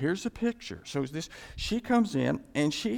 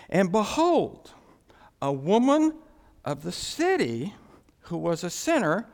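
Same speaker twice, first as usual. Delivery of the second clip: dramatic scripture reading; 3 semitones higher, 5 dB louder than usual. Acted. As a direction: proclaim it like a grand reveal. Preacher reads scripture dramatically.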